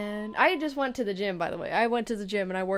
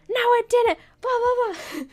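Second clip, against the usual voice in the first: very high, loud mock protest; freaking-out mom; 9 dB louder than usual. Mom denying what the sister says, shrieking in panic. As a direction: squeal it like a panicking mother. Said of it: "my mom's freaking out".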